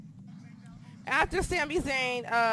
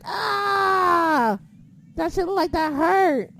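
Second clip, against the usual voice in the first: higher, louder cry of sympathy at a nasty hit; pained shout; 6 semitones higher, 7 dB louder, much slower than usual. Retold or imitated. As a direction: yell it like you just watched it hurt. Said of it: "I was like".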